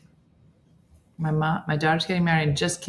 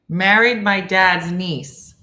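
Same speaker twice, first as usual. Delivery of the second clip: loud, deliberate reading; slow puzzling-out; 3 semitones higher, 6 dB louder, much slower than usual. Real, not acted. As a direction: lay out each word slowly. Slowly working through a confusing comment.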